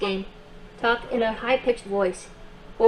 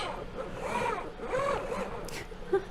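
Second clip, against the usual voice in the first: giggle; 12 semitones higher, 10 dB quieter than usual. Acted. Robot laughs after mustache joke.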